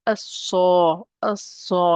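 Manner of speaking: As-sa with a dark, doubled S, no L; weight the S and its vowel in As-sa; heavy letter. Shown as a demonstration. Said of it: "It's a heavy letter".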